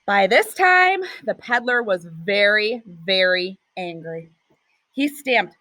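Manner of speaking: ordinary delivery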